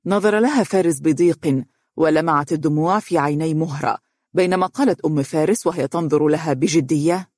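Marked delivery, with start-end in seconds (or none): none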